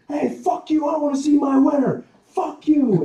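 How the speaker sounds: high, loud rowdy heckler; pushy spectator cursing; angry crowd member. Acted. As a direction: shove back and yell at the crowd. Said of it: "People are going".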